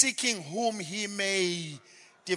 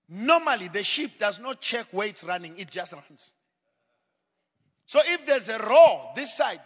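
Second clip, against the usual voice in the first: usual speaking voice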